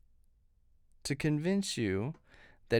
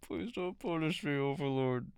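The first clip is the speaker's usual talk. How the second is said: mock pleading.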